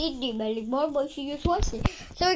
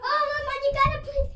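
pleading; 11 semitones higher, faster than usual. Real, not acted. Fast pleading to mama.

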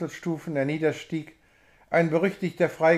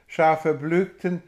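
ordinary delivery

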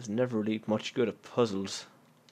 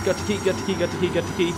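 frantic; 6 semitones higher, 8 dB louder, faster than usual. Real, not acted. Frantic repeating while fleeing.

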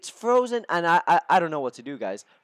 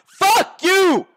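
furious shout; 13 semitones higher, 8 dB louder than usual. Real, not acted. Yelling at a conceded goal.

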